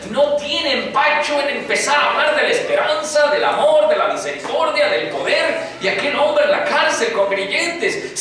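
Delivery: high, ringing preacher's proclamation; full-voiced pulpit preaching; fervent preaching. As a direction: lift the church with Paul's hope. Preaching builds to full force.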